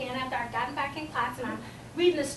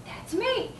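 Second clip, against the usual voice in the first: normal talking voice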